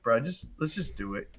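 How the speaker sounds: softly retold, played-down reassurance; hiding fear. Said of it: "let him not see the fear".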